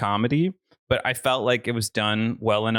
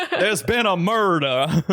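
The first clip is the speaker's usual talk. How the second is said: lightly drawled Southern line; a hint of a slow Southern drawl; Andy; 5 semitones higher, slower than usual. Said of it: "it's like Andy in The Office".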